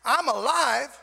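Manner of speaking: loud, high declaration; exuberant shout. Acted. Answering how are you doing with a changed attitude, exuberant shout. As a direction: belt it out triumphantly. Acts upbeat answer to the question.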